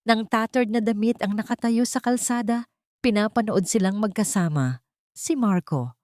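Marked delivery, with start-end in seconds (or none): none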